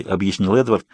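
plain reading voice

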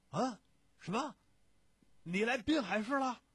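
high, booming astonished questions; loud surprise. A narrator's reading. Friend learns his pal is in Binhai, loudly surprised. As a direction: exclaim it high and loud in disbelief.

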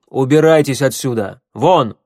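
loud order to get out; forceful, fed-up command; angry dismissal. A narrator's reading. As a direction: throw them out with a shout. Angrily ordering her out.

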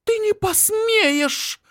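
очень высокий медленный шипящий голос; шипит с угрозой, тянет «Ты»; hissing. Acted. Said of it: "шипит она". (шипит с угрозой)

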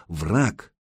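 ordinary delivery, nothing marked

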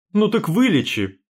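high, loud enraged yell; furious shout. A narrator's reading. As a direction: explode at him in rage. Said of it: "взбесилась я".

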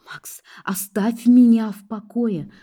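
high, drawn-out acted outburst; slow, high cry to be left alone; exasperated cry. A narrator's reading. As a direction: drag it out in exasperation. Heroine cries out at pursuer.

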